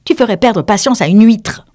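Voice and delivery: high, loud snappish retort; peevish. A narrator's reading. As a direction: complain testily to mother. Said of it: "non sans quelque hargne".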